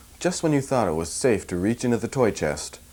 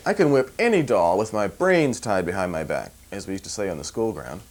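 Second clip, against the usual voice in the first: usual speaking voice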